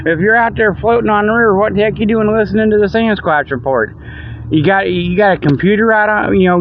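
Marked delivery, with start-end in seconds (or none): none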